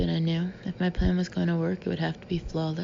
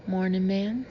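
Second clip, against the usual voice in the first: friendly Texas drawl on a greeting; lightly voiced Texan kid, easygoing; Texas accent. Beach kid greets the narrator in a Texas accent. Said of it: "he said in a Texas accent".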